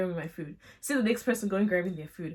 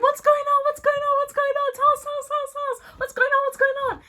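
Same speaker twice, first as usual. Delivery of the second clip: much higher, louder, eager chant; excited clamoring; 5 dB louder, faster than usual. Retold or imitated. Everyone begging Tolu to tell them, high excited clamor. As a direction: demand the gossip, bouncing with excitement.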